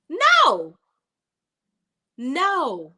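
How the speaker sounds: high, loud "No."; emphatic no. Real, not acted. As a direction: shut the idea down hard. Rejecting the imagined confession.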